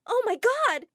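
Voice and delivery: high, loud startled yell; girl yelling when a man rises from under the desk; yelled in fright. Acted. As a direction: yell it as someone jumps out at you. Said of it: "I yelled".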